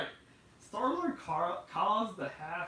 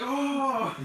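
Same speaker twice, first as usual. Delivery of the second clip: laughing gasp; 9 semitones higher, 11 dB louder than usual. Retold or imitated. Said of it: "I was like".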